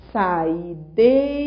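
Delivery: "Saideira" stretched out very slowly, every sound drawn long; slow, careful pronunciation. Shown as a demonstration. Teacher slowing down "Saideira" so students find the strong vowel. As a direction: say "Saideira" slowly and calmly so the stress on "dei" is clear. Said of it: "É só você pronunciar com calma".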